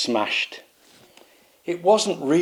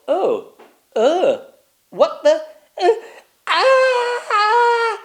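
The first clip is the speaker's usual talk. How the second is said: rising, very high startled scream; shocked queen; 5 dB louder, much slower than usual. Acted. Queen stammering then shrieking at the mirror.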